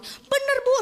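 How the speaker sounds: high-pitched frightened plea; mock panicked insisting. Nanny insisting to her employer the ghost is real, mock panic. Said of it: "pasti teriak-teriak".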